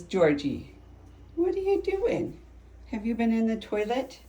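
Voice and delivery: high mock-scolding voice to a cat; pet scolding. Real, not acted.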